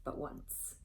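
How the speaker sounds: whispered warning; hushed spirit's warning. Acted. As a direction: breathe it as a secret.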